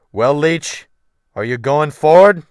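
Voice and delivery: loud, commanding captain's question; stern captain. Acted. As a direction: demand an answer from the beaten boy. Captain questioning cabin boy.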